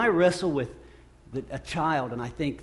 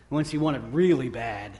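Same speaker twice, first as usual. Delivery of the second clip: normal talking voice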